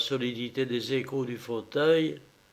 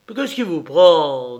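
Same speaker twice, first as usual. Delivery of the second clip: frightened cry; 3 semitones higher, 10 dB louder than usual. Acted. Bound prisoner cries out in fear.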